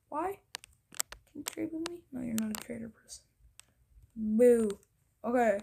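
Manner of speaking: ordinary talk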